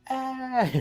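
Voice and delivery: short high-pitched mock shriek; imitated frightened yelp; mock scream. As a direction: let out a fake panicked squeal. Imitates the panicking group.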